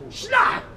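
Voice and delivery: shouted sound of snapping elastic; elastic snap. Retold or imitated. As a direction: mouth the snap of a released rubber band.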